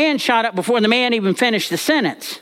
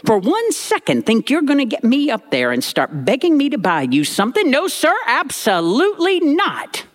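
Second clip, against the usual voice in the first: a hint of a stern granny, pitched up; mock granny warning; 6 semitones higher than usual. Granny warning grandson not to beg for things, stern. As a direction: wag a finger as granny. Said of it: "she turned to me and said".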